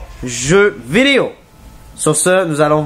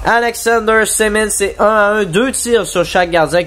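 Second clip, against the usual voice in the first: excited sports commentary; 7 semitones higher than usual. Acted. Announcing the goal scorer.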